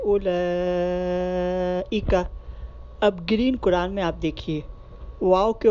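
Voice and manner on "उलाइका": उलाइका recited slowly without the waw, long final aa; slow recitation of उलाइका, waw silent, ending stretched; recited Quran word. Shown as a demonstration. Teacher showing students how to recite उलाइका, slow Quranic chant. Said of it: "हम ऐसे पढ़ते हैं".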